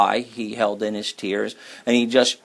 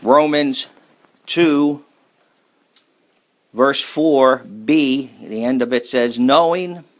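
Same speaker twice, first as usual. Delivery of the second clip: slow, raised scripture reading with weight; 5 dB louder, slower than usual. Acted. Preacher reads Romans to congregation, slow and solemn. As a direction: read the verse to the church slowly.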